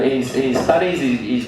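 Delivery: ordinary talk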